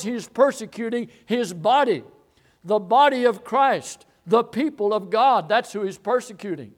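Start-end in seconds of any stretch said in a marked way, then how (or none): none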